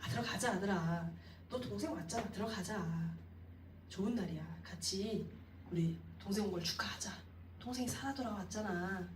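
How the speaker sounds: soft, tender coaxing; gentle pleading. Shown as a demonstration. Father softly pleading with his sulking elder son, tender. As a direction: plead gently, staying close beside him. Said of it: "계속 권했다라는 거예요".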